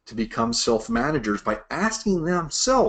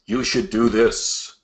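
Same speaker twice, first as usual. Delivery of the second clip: slow, heavy, commanding boss voice; bossy deep voice; slower than usual. Retold or imitated. Old boss telling his rep what to do, slow and commanding. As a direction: lay down the order like an old-school boss. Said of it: "with that deep voice, and sounds like they're yelling".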